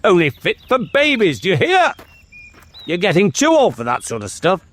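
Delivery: high-pitched scolding from a king; stern. Acted. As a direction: sternly put a child in his place. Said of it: "said the king sternly".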